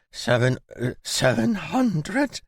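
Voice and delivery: high, slow stammered disbelief; stammering. A narrator's reading. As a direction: stutter it out in shock. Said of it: "he stammered".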